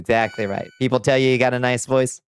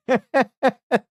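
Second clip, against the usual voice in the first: squeaky high laugh; high giggle; 14 semitones higher than usual. Real, not acted. Laughing at meow advice.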